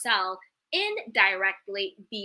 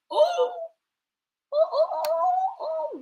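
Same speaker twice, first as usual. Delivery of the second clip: shocked oh; slower than usual. Real, not acted. Shocked by Reese's comment.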